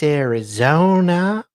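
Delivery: ordinary delivery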